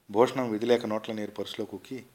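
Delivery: ordinary delivery, nothing marked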